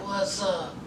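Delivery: low, quiet, offhand mumble; Michael's flat reply. Retold or imitated. New dad Michael answering Sarah's call, low and quiet. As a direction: mutter it low like you barely care. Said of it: "And so Michael was like".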